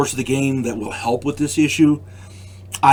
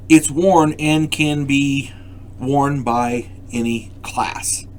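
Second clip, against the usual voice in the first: slow and deliberate on worn and can be worn by any class; stretched, teacherly emphasis; much slower than usual. Shown as a demonstration. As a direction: spell it out slowly so it sinks in. Repeats key point, slowly stressed.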